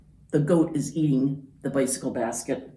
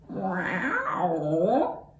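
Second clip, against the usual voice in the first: cat meow; 3 semitones higher than usual. Acted. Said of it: "the cat said".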